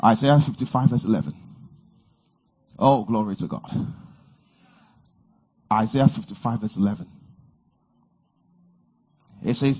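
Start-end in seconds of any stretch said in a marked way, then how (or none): none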